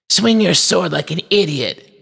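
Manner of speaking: put-on high, jeering boy's voice; taunting boy. A narrator's reading. Boy jeers at a toy knight, taunting. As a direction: taunt in a high singsong that falls away. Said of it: "changed into a taunting sound".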